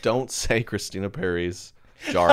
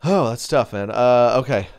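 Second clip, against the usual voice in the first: normal talking voice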